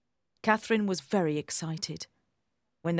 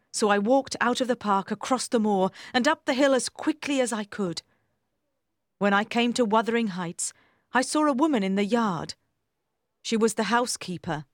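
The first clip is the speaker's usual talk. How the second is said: usual speaking voice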